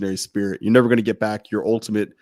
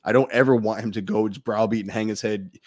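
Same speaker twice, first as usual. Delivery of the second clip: ordinary talk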